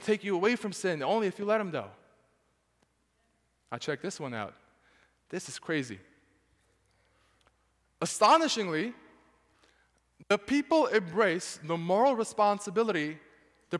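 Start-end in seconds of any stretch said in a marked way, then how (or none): none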